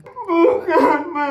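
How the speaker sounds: crying. Acted. Daughter Sari crying to mother.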